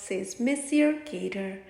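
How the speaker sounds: usual speaking voice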